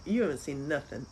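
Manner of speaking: normal talking voice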